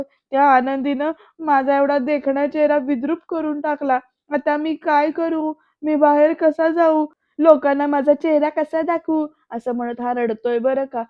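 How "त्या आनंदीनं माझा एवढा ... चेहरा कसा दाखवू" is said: a mild acted wail over a ruined face; crying lament. A narrator's reading. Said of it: "रडत म्हणतो". (रडत, हलकीशी विलापाची हाक)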